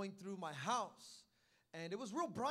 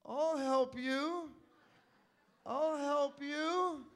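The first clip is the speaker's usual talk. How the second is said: very slow, loud teasing sing-song; mocking drawl; 4 semitones higher, 7 dB louder, much slower than usual. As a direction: stretch each word to mock him. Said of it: "He's like".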